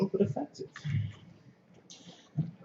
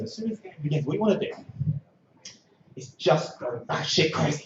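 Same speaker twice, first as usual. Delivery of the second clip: ordinary talk, nothing marked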